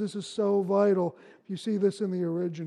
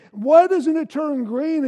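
impatient; 6 semitones higher, 7 dB louder, slower than usual. Retold or imitated. Impatient at the red light.

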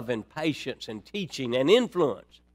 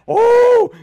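a high, loud gasp of dread; 12 dB louder than usual. Retold or imitated. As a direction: yelp like a kid caught red-handed. Child facing mother's look.

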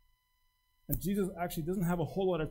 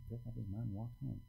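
blasé retelling; 6 semitones lower, 10 dB quieter than usual. Retold or imitated. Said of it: "we're like".

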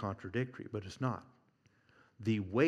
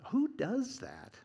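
much higher, slow disbelieving "Who does that?"; incredulous; 12 semitones higher, slower than usual. Real, not acted. Preacher mocking the disciples, incredulous and high. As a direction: ask it in comic disbelief.

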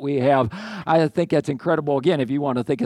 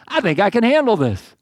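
confident God; 6 semitones higher, 4 dB louder than usual. Acted. Voicing God's confident reply to Abraham.

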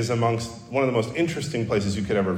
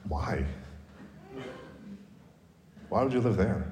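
softly incredulous, politely appalled; understated, hushed disdain; polite British disdain; 11 dB quieter than usual. Retold or imitated. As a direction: quietly look down on the place. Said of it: "all of the polite disdain".

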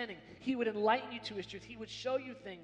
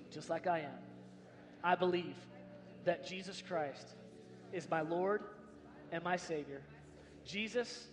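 guided prayer; 3 semitones lower than usual. Acted. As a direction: lead them gently through the prayer. Leads congregation in prayer.

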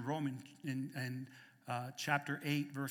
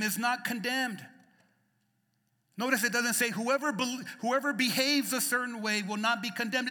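loud, high, impassioned preaching; preaching at full force; 8 semitones higher, 9 dB louder than usual. Acted. Preacher urging the congregation to pray, impassioned. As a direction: drive it home from the pulpit.

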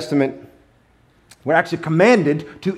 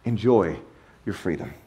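Enjoy your freedom said much softer and lower; quiet repeat; 8 semitones lower, 7 dB quieter than usual. Shown as a demonstration. Preacher quietly restating God's will to the congregation. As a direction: drop to a soft, low echo of Enjoy your freedom. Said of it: "And that's it".

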